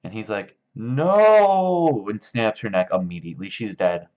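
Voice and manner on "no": very loud, high, drawn-out shout; hammed-up roar. Lenny snaps as she pulls away, in a long mock shout. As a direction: bellow it long like an exploding man-child.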